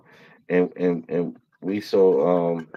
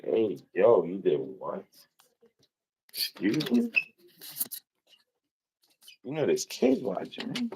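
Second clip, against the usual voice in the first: mock scolding; 8 dB quieter than usual. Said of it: "getting called out".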